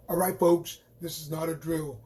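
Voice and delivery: a playful commander rallying the team; put-on rally voice. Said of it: "I don't know why I had to make that voice".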